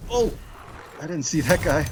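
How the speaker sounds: jolted yelp, high and loud; real startled outburst. Real, not acted. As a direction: caught off guard by an enemy. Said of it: "Scared me".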